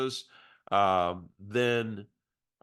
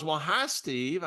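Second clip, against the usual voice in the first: higher, slow put-on woman's voice; mimicking the caller's greeting, higher and slower; imitating Barbara; 6 semitones higher, slower than usual. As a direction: drag out her sweet hello, pitched up. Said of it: "I'm gonna have to use her voice for impact".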